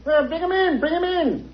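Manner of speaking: put-on high, distant old man's call; calling from offstage. Uncle telling his niece to bring the visitors in, distant call. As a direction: shout it from the next room.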